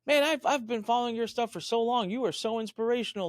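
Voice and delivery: gushing fan. Retold or imitated. Security guard telling the client how much he admires him, eager high gush. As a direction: pour it out like a devoted follower. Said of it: "And he's like".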